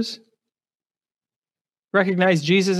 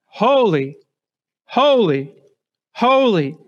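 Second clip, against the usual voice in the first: building holy; 3 semitones higher, slower than usual. Acted. Seraphim's song quoted in sermon.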